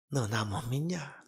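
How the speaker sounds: soft, slow reproachful question; God. Acted. God answering the prophet's complaint, soft slow reproach. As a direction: ask it low, softly and slowly. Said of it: "하나님의 대답이 뭡니까".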